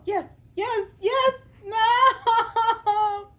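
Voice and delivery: high, loud excited yelps; excited squeal. Real, not acted. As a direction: cheer the bird on, squealing. Bird moves toward perch.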